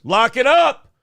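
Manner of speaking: loud shouted command; shouted order. Retold or imitated. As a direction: bark it down the manhole. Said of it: "throws it down the manhole and goes".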